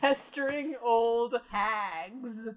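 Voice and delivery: slow. Real, not acted. Repeating the phrase slowly.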